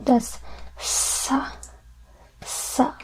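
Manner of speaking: sa said softly with an S sound. Shown as a demonstration. Teacher shows soft C to students, sa. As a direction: breathe out sa, sa with a soft S. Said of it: "that becomes a sa".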